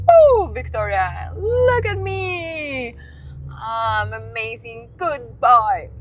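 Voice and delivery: put-on preen like a spoiled boy; mocking boyfriend. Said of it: "And then my birthday comes".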